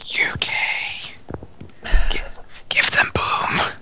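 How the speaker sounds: slow conspiratorial whisper. Pretend. Cohost whispering a plea to share the show, slow whisper. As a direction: breathe it slowly like a secret plea. Whispered aside about giving Boom.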